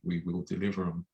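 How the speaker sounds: ordinary delivery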